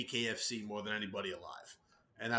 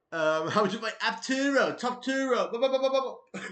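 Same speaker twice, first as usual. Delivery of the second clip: mock callout; 11 semitones higher, 10 dB louder than usual. Mock imitation after Conor bit.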